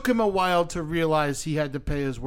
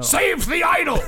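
dramatic last-words cry; mock shout; 13 semitones higher, 6 dB louder than usual. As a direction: shout it out with laughter.

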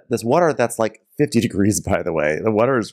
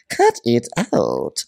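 Full House catchphrase; 3 semitones higher, much slower than usual. Retold or imitated. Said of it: "doing Full House lines".

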